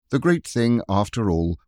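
usual speaking voice

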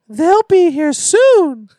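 put-on high, slow eerie child voice; slow and high like a creepy kid.

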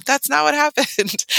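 laughing. Real, not acted. Host laughs at own joke.

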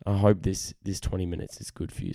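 normal talking voice

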